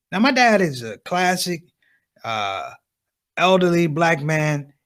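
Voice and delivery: ordinary talk, nothing marked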